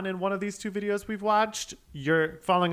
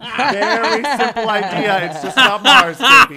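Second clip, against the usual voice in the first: loud laughing shout, building higher; hyped shouting; 6 semitones higher, 13 dB louder, slower than usual. Acted. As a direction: yell it out with glee. Mock hype over the video.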